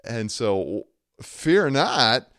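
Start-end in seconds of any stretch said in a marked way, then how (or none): 1.2-2.3 loud, high, emphatic order; unempathetic command. Acted. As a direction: proclaim it forcefully, without sympathy. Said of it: "that sounds like he's totally contradicting".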